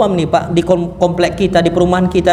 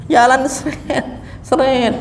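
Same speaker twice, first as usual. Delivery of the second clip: mocking laugh; 6 semitones higher, slower than usual. Mocking lazy dragging walk.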